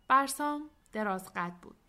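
plain reading voice

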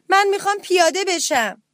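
high-pitched anxious plea; frightened mouse. Acted. Scared little mouse to parrot captain, in a high plea. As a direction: plead urgently to get off.

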